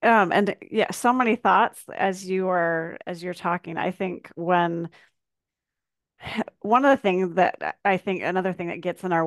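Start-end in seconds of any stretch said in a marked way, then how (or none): none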